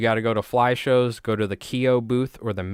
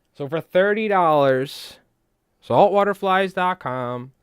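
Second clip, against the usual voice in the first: high, loud, slow plug; sales pitch; 8 semitones higher, 4 dB louder, slower than usual. Real, not acted. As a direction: announce the shop like an ad. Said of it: "plugging the shop".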